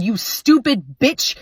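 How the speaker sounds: slow, loud insulting yell; husband yelling at his wife over the dishes, slow and loud. Acted. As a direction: bark the insult slowly. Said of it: "He yelled".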